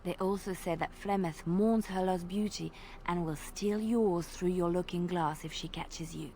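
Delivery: normal talking voice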